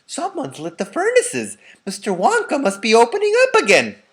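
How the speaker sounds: high, loud townsfolk exclaiming; excited crowd. A narrator's reading. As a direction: announce the news with eager wonder. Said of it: "they cried".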